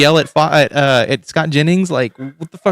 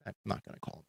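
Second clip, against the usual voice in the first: quiet mock mutter; 5 semitones lower, 10 dB quieter, much faster than usual. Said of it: "I was just like".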